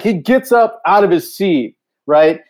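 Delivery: normal talking voice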